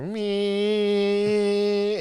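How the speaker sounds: high, sustained motor drone; imitated dustbuster whine. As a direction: hold a high vacuum-cleaner whine. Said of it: "you just hear".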